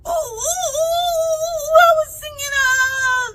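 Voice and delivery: very high squeaky whimper; squeaky rabbit. Acted. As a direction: squeal like a caught little rabbit. Rabbit squeezed and caught.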